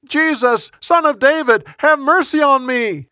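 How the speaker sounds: high, pleading yell. A narrator's reading. Blind Bartimaeus calls to Jesus as he leaves Jericho, yelling. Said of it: "started yelling".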